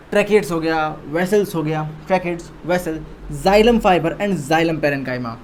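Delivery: ordinary delivery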